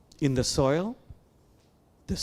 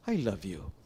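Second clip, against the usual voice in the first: soft, lower, tender "I love you"; tender self-talk; 7 semitones lower, 6 dB quieter than usual. Shown as a demonstration. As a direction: say it gently to your own heart. Telling the heart during meditation.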